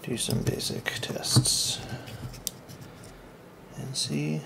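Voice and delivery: soft whispered aside. Real, not acted. Tinkerer whispering his next step to himself. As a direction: whisper it under your breath.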